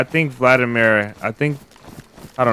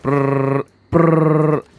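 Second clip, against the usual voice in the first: imitated burst of gunfire, the second one higher; rolled-lip brrr like a machine gun; mock gunfire; 6 semitones higher than usual. Describing a fight he heard to viewers, mock gunfire noise. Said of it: "it sounded like he said".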